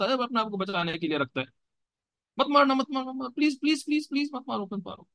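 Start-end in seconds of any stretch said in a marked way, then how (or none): 2.4-5.1 very high, squeaky pleading; scared little child. Acted. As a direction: mimic a small child begging not to be hit. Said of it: "छोटा बच्चा क्या सोचता है".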